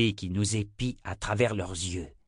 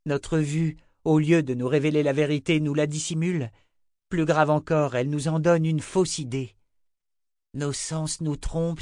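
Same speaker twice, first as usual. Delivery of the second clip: higher-pitched, insistent lecturing; earnest young believer, preaching; 6 semitones higher, 4 dB louder than usual. Acted. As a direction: explain your creed in a young man's voice. Owen expounds his creed.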